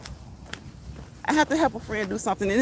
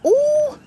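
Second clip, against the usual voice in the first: surprised ooh; 6 dB louder than usual. Real, not acted. Hearing of a broken toe.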